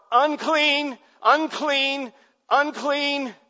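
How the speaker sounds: loud high cry, falling on each word; ringing leper's cry; leper's shout. Pretend. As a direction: call out the warning, loud and high. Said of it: "required by the law to shout".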